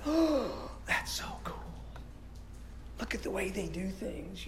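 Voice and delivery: put-on little boy's hushed awe, whispered; awed whisper. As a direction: whisper it like a child watching altar boys. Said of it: "and I go like".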